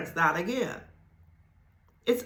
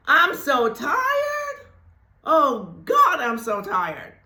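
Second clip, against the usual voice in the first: hammed-up groan of being tired, higher and breaking; mock weary moan; 4 semitones higher, slower than usual. To the congregation, mimicking a tired person's weary moan. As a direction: whine about being so tired like a worn-out person.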